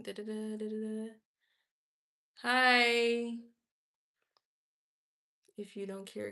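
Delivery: normal talking voice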